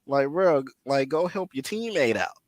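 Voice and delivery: high-pitched, worked-up calling out; exasperated yelling. Retold or imitated. Viewer urging wrestler to help his partner, exasperated yelling. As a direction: holler in disbelief at a useless teammate. Venting at a lazy tag partner.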